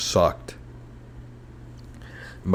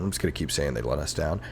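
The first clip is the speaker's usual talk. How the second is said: usual speaking voice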